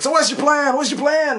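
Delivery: high, urgent movie-character voice; mock-frantic questioning; retold demand.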